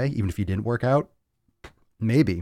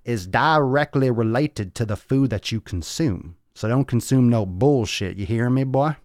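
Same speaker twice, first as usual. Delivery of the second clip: a light put-on street accent, starting higher and loud, then slower; a little put-on accent; 4 semitones higher, 3 dB louder than usual. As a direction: lay on a little put-on accent to preach at the viewers. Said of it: "I'm gonna get a little accent on this one".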